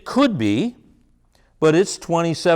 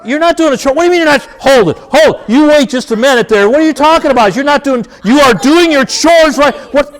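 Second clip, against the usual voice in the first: much higher and louder, furious outburst; angry parent yelling; 13 semitones higher, 10 dB louder than usual. Shown as a demonstration. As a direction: blow up at the defiant kid like a parent losing it. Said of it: "Look at where that went".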